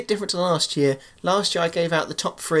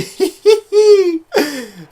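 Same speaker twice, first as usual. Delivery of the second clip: loud, high-pitched laughing fit; high giggle; 13 semitones higher, 8 dB louder than usual. Real, not acted. Commentator enjoying a three nil win, high giggling laugh. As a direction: squeal with laughter, trailing down.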